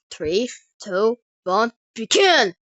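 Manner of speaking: fight countdown. Acted. Giant counts down the fight, building to a loud call. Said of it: "counts down on the start of the fight".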